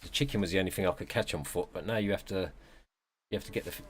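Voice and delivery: ordinary talk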